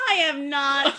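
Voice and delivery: loud, high protest from across the room; yelling back at the insult; indignant shout. Real, not acted. Friend shouting a denial at the reader.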